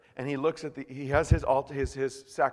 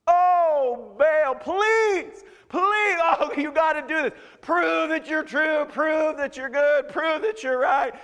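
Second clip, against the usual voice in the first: loud, high, hammed-up wailing plea; frantic begging; 14 semitones higher, 9 dB louder, slower than usual. Prophets begging Baal to prove himself, desperate. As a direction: drag out each demand in mock desperation. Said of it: "and they start begging".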